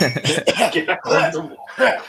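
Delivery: raspy smoker voice, slow; slow rasp like a heavy smoker. Pretend. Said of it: "It's my smoker voice".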